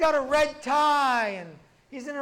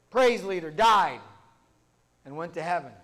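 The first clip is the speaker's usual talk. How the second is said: ordinary talk, nothing marked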